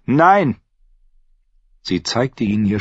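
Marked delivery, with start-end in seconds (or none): 0.1-0.6 high, loud cry of defiance; shouting. A narrator's reading. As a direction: leap up and yell it. Arya sprang auf.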